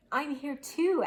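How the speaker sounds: crying out. A narrator's reading. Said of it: "Owl cried".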